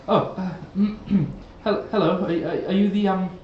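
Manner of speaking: hint of a flustered bearded man; bearded fellow. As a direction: stammer out an uncertain greeting. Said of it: "a bearded fellow with long, brown".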